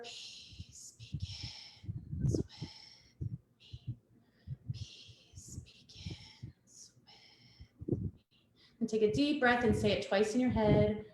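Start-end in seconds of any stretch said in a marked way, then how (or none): 0.0-8.1 slow breathy whisper of the mantra, much softer. Shown as a demonstration. Yoga teacher having the children whisper the mantra, slow whisper. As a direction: drop to a hush and whisper each word slowly. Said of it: "And then we're whisper".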